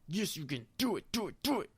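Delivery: squeaky rising chant of do it; frantic self hype. Retold or imitated. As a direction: egg yourself on, climbing in pitch. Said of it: "I'm like".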